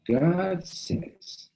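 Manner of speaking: high, drawn-out climactic answer; emphatic pronouncement. Retold or imitated. Young rabbi reveals his ultimate source, emphatic. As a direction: pronounce it as the final authority.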